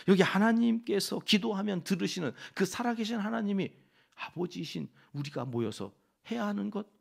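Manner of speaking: normal talking voice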